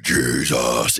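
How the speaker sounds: deep growled metal voice; Cookie Monster vocals. Retold or imitated. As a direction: growl it like a death metal singer. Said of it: "the Cookie Monster vocals".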